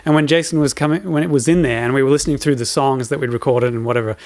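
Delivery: normal talking voice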